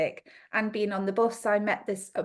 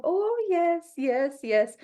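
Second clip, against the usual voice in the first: a hint of a Ukrainian accent, higher; mock Ukrainian accent, nodding along; 8 semitones higher, much slower than usual. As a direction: lightly mimic a Ukrainian woman agreeing. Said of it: "excuse the terrible accent".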